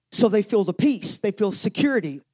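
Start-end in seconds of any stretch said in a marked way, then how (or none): none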